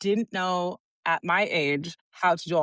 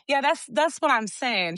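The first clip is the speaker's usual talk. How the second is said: higher-pitched, lightly voiced sister chiming in; imitated sister; 6 semitones higher than usual. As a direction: imitate your sister backing you up. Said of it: "Candace goes like this".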